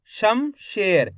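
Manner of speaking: Shamsher drawn out very slowly for dictation. Shown as a demonstration. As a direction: say Shamsher slowly for the class to write. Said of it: "उच्चारण करो कमलेश, इस तरीके से".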